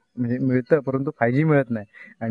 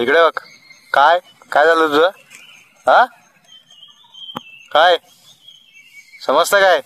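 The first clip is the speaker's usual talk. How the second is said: raised, rising teasing calls; teasing call to a monkey; 4 semitones higher, 5 dB louder than usual. Real, not acted. Teasing a monkey that ignores him, raised calls. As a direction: taunt the monkey to get its attention.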